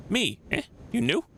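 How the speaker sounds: a lightly voiced shifty little man; squeaky, prying street rat; suspicious beggar. Voicing Quint's suspicious questions.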